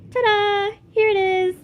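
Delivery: proudly unveiling the room, loud and high; ta-da reveal. A narrator's reading. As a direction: present the room with a flourish. Showing off the room.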